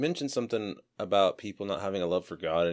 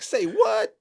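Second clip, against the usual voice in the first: high, loud put-on exclamation; exaggerated, high-pitched mock disbelief; 8 dB louder than usual.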